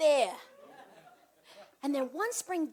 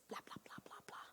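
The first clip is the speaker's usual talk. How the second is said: whispered blah blah; 24 dB quieter, faster than usual. Real, not acted. Skipping the details.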